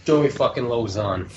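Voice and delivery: put-on lightly done Boston accent, low and soft. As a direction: say the name like a Boston local. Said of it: "I like your Boston accent".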